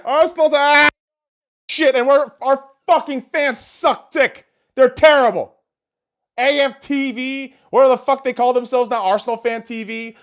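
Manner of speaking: fed-up fan shouting at AFTV; exasperated rant. Real, not acted. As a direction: yell at the dipshits out there. Ranting at Arsenal fan channel.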